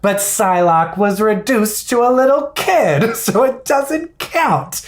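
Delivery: put-on high, slow childish whine; whiny kid voice. Retold childhood argument, kid voice.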